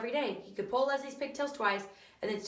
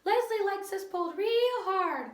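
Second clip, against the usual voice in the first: high, louder, slow little voice; pigtail voice; 10 semitones higher, 4 dB louder, slower than usual. A narrator's reading. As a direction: scold him gently for being weak. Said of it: "said the pigtail".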